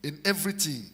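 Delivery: ordinary talk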